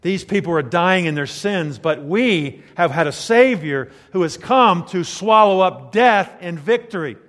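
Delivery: high, loud pulpit proclamation; full-force preaching. Real, not acted. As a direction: build to a loud, drawn-out climax.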